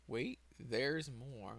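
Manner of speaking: slow, soft, words drawn out; note to self. Real, not acted. Speaker reminding self to come back to it, slow soft note to self. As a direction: say it slowly to yourself as a reminder.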